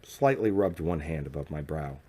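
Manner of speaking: plain reading voice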